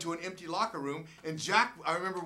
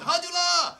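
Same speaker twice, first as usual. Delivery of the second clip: much higher, louder fan cheer; fans yelling; 13 dB louder, slower than usual. Retold or imitated. Japanese fans yelling after the game, high excited cheer. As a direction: call out his name like an excited fan. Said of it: "the fans would be yelling".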